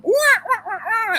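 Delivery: high-pitched mock monkey whoops; monkey screech. Imitates monkeys coming up.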